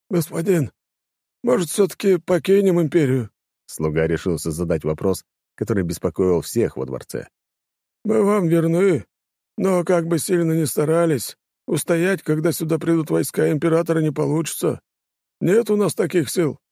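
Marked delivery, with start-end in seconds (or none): 0.1-3.3 put-on high, pleading old servant. A narrator's reading. 8.0-16.6 put-on high, pleading old servant. A narrator's reading.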